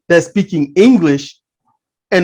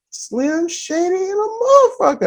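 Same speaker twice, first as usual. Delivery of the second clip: high, softer mimicked voice; imitated assistant supervisor; 10 semitones higher, 6 dB quieter than usual. Assistant supervisor to the dock attendant, imitated high and soft. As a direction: imitate a colleague in a raised, held-back pitch. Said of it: "He was like".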